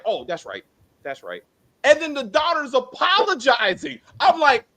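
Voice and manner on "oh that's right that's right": mock sheepish father. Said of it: "And he's like".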